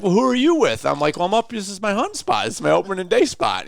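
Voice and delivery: high, lively back-and-forth; thrilled at the coincidence; excited banter. Retold or imitated. Excited reunion banter in woods.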